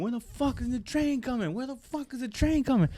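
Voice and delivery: high, soft mock yell; retold repetitive yelling. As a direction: repeat it as an impatient rant, volume held back. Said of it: "he just kept repeating".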